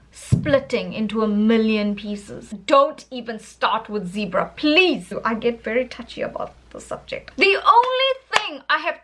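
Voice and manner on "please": normal talking voice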